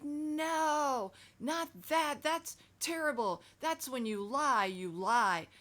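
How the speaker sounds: loud, high mock yell; scolding adult. Adult shaming the child for telling the truth, loud mock scolding.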